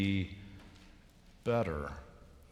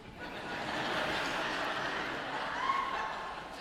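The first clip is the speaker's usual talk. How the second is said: laughing; 5 dB quieter than usual. Real, not acted. Laugh after punchline.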